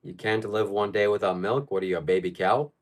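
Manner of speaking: ordinary talk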